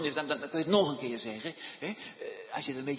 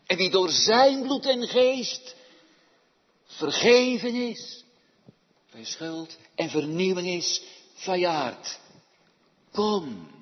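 loud, raised pulpit appeal; fervent preaching; 4 semitones higher, 10 dB louder, slower than usual. Real, not acted. Preacher summons hearers to forgiveness, preaching loudly.

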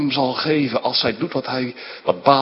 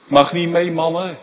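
normal talking voice

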